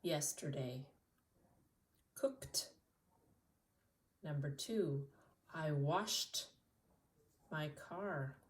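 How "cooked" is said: whispered past tense word. Shown as a demonstration. Teacher whispering the drilled word to the class. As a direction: breathe the word out with no voice. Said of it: "I cooked".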